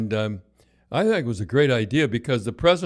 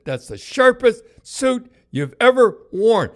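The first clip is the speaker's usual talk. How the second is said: much higher, louder, slow mock voice; referee's compliment; 11 semitones higher, 7 dB louder, slower than usual. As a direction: ham up the referee's verdict.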